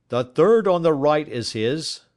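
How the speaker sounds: a lightly voiced official showing the way; a lightly voiced inspector pointing out the cell; inspector's voice. A narrator's reading. Inspector's voice in a read story.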